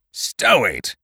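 short, scornful order; curt command. A narrator's reading. Winter silencing the gunner with a curt order. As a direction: shut him up. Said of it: "commanded Thomas Winter".

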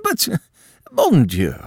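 loud, high cry of dismay; exclaiming countess. A narrator's reading. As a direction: throw up your hands in horror. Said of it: "cried the Countess".

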